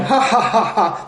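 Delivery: mock ha-ha laugh; imitated laughing. The senator laughing off the question, mock laugh. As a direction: mimic a smug roll of laughter. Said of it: "he rolls up laughing".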